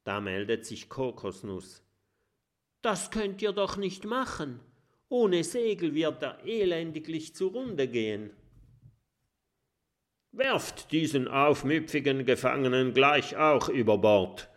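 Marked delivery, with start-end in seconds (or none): 2.8-8.3 little dragon. Acted. 10.3-14.5 a bit louder, commanding; captain ordering prisoner overboard; stern order. Acted. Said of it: "befiehlt Haifisch Erik".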